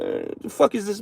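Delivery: high, hushed disbelief; incredulous quote. Retold or imitated. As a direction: act baffled at the mess. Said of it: "sometimes it be like".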